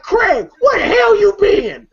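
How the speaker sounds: high, drawn-out scolding shout; imitated yelling. Uncle Elroy yelling at Craig, imitated scolding. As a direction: holler it like an irate movie uncle. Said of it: "supposed to be yelling at him".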